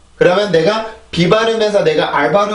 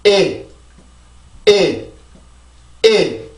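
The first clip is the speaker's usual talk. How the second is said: "일" as a stressed beat with falling pitch; stressed syllable demo; much slower than usual. Shown as a demonstration. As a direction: punch out each "일" separately. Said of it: "강세를 만들어줍니다".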